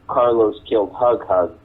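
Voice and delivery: normal talking voice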